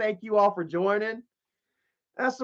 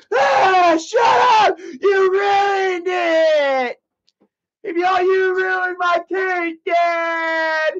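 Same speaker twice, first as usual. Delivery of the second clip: high, loud fake-crying yell; a little brother sobbing and shouting for Dad; crybaby tantrum; 9 semitones higher, 5 dB louder, much slower than usual. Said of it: "Mike would be like".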